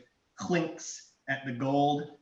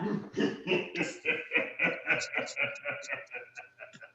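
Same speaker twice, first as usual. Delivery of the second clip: long, rattling evil chuckle; villainous dragon laugh; 3 dB quieter than usual. Acted. Game master voicing the dragon, villainous laugh. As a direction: let the dragon's chuckle run on and on. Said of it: "you hear another laugh".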